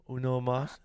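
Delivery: high, loud put-on accent; imitating Jackie Chan. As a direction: mimic Jackie Chan's movie line. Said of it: "I can just think of his shit in his head".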